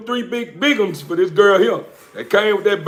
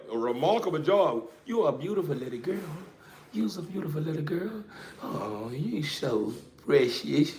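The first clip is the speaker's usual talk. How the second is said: much softer tender coo; hushed cooing; 2 semitones lower, 12 dB quieter than usual. Real, not acted. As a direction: murmur softly so the puppy stays calm. Hushed praise to puppy.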